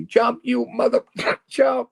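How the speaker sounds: high, strained imitation of a heckler's yell; retold yelling. Campers heckling the man on the bridge, retold yelling. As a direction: yell it up at them, pitched way high. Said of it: "they're yelling at me".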